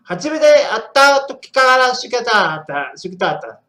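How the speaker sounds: loud, high mock Japanese phrase; put-on Japanese. Shown as a demonstration. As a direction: belt out the Japanese line. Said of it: "Έχω την προφορά".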